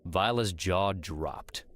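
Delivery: usual speaking voice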